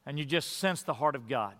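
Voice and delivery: ordinary talk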